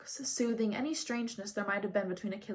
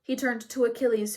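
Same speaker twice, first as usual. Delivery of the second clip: usual speaking voice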